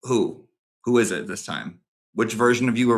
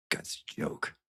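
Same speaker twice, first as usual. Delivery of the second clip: dismissive whisper; whispered aside; 15 dB quieter than usual. Pretend. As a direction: breathe the put-down almost silently. Dismissive aside after confrontation.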